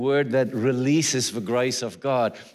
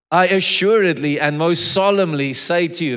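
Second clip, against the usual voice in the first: louder, higher preaching of scripture; preaching at full force; 5 semitones higher, 7 dB louder than usual. Acted. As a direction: proclaim the verse with rising force.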